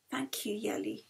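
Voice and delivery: faint, breathless whisper; a frail patient's whispered thanks; weak whisper. A narrator's reading. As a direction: barely get the words out. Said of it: "she managed".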